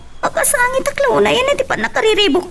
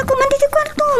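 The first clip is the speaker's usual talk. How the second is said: crying; 6 semitones higher than usual. Acted. Daughter pleading in tears.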